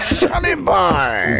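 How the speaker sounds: slow, stretched teasing call; teasing a friend with a nickname; 1 mock sing-song. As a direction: drag it out teasingly. Mocking nickname.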